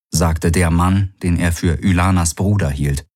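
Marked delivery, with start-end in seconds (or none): none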